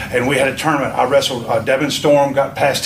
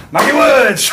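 much higher and louder, a big call-out of the name; excited shout; 12 semitones higher, 6 dB louder, slower than usual. Acted. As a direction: bellow it like a ring announcer. Calling the wrestler's name.

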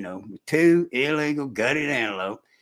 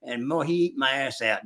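normal talking voice

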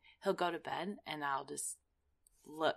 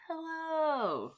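dramatic hello; 11 semitones higher than usual. Retold or imitated. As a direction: call "Hello" across the room. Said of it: "like, across the room".

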